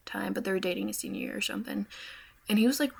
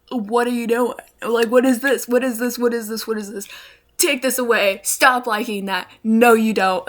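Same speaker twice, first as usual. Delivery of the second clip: loud, exasperated repeated questions; exasperated yelling; 2 semitones higher, 9 dB louder than usual. Retold or imitated. Yelling at her brother's liked videos, loud and exasperated. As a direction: shout it at the screen in disbelief. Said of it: "and I'm like".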